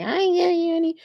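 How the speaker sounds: high-pitched refusal. Acted. Joking about refusing the kids food, high mock squeal. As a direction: push it way up high and hold it.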